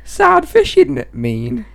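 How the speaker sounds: crying with laughter; laughing. Real, not acted. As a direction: talk through helpless laughter. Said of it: "never laughed so much".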